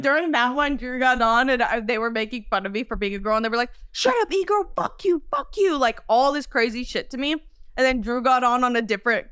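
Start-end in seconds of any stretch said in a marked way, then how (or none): 3.9-5.8 high mock kid insults; jeering kid. Kids mock her for being a girl, high jeer. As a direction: imitate a kid hurling abuse, pitched up.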